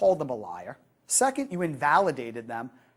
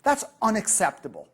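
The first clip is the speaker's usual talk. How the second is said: matching intensity; 5 semitones higher, 4 dB louder than usual. Shown as a demonstration. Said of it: "how you respond is".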